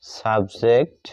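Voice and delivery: normal talking voice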